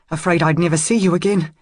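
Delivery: plain reading voice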